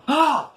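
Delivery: high short mock scream of shock; retold scream. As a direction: let out a sudden yelp of dismay. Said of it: "I let out a scream".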